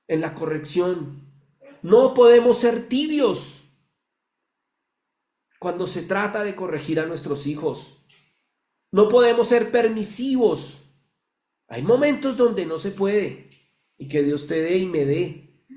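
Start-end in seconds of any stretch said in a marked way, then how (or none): 1.8-3.6 forceful preaching. Real, not acted. Repeated exhortation. 8.9-10.8 forceful preaching. Real, not acted. Preacher exhorting parents.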